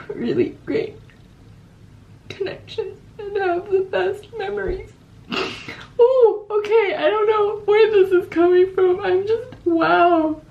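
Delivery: real tears breaking through the words; crying. Real, not acted. Student saying goodbye to her exchange city, crying. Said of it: "Wow, I am emotional".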